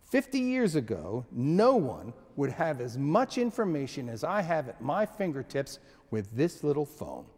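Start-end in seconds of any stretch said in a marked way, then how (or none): none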